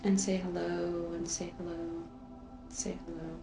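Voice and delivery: hushed, trailing echo of the same invitation; fading soft repetition. Real, not acted. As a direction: let the words die away gently. Repeated greeting fading into the meditation.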